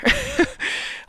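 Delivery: a high, breathless burst of laughter at himself; embarrassed laugh. Real, not acted. Streamer laughing at his own heated rant, high breathless laugh. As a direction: laugh off your own outburst.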